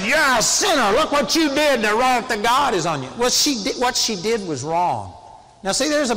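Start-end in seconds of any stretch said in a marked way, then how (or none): 0.0-3.1 harsh, high and loud preacher's condemnation; condemning shout. Retold or imitated. Religious preacher shouting condemnation at a repentant woman, furious shout. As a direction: thunder it at her like a hellfire preacher. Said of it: "religion would have come out and said".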